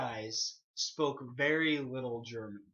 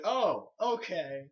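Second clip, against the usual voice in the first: drawn-out, higher confused reaction; 5 semitones higher, 4 dB louder, much slower than usual. Retold or imitated. Confused man suddenly hearing English from a German speaker, drawn-out realization. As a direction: slowly catch on, pitch lifted. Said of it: "he was very confused".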